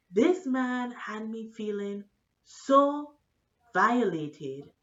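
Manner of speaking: ordinary talk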